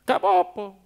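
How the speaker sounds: ordinary delivery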